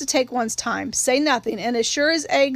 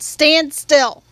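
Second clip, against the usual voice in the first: stern command; 3 semitones higher, 6 dB louder than usual. A narrator's reading. Uncle pins struggling girl.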